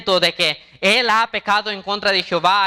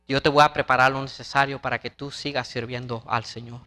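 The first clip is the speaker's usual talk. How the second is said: tender vow; 8 semitones lower than usual. A narrator's reading. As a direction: speak gently and earnestly to your boy. Father's vow to his son.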